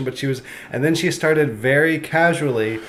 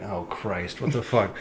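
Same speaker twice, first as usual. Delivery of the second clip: retold dread; 5 semitones lower, 10 dB quieter, slower than usual. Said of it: "And I was like".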